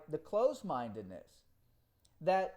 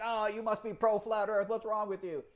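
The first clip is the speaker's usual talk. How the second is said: high, louder accusing mimicry; mock freak-out; 5 semitones higher, 4 dB louder than usual. Critics freaking out over his videos, high mock accusation. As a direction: imitate an outraged accuser. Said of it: "people freaked out".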